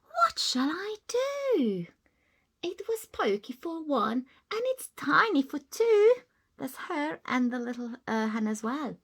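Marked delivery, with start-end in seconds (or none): 0.0-6.2 put-on high whispered fretting old lady; distressed old lady. Old lady fretting over her tiny house, distressed. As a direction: fret in a high hushed voice. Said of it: "The little old lady cried".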